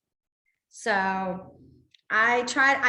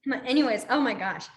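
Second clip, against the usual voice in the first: ordinary delivery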